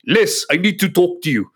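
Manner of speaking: a light mimicked South African accent, tall client complaining; hint of a South African accent; South African accent imitation. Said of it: "This is my mimicry".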